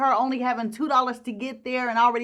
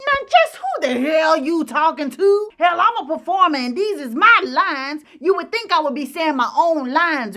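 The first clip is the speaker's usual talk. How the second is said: louder, higher, offended retort; mock indignant diva; 4 semitones higher, 5 dB louder than usual. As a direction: put the upstart in their place. Ethel snapping at corrected line.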